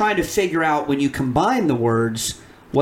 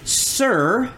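sounding out words; 4 semitones higher, much slower than usual. Shown as a demonstration. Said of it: "reading them slowly".

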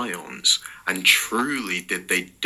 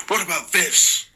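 high, sharp angry question; angrily; 8 semitones higher, 3 dB louder than usual. Acted. Eric angrily grabbing at Jordan's robe. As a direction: demand it with a sharp high edge. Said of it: "he said angrily".

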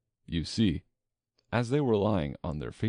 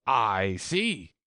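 loud, stretched royal pronouncement; loud imperious queen voice; stern queen; 3 semitones higher, 5 dB louder than usual. A narrator's reading. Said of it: "said the queen".

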